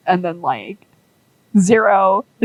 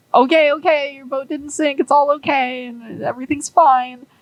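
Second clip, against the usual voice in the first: put-on higher, gently calming a crying child; soothing reassurance; 7 semitones higher than usual. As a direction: coax a frightened kid that all is well. Zero soothing crying Zip.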